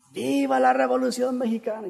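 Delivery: high, held call without full volume; imitated grito. Stand-in at the tribune addressing the town, imitated shout. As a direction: mimic a rally cry at moderate volume. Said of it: "empieza a gritar".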